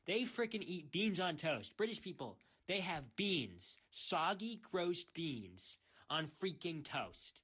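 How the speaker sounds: worked-up, hammering indignation; heated rant. Real, not acted. Ranter hits back at British pineapple critics, heated. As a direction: get carried away, punching each complaint. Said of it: "I got a little heated there for a minute".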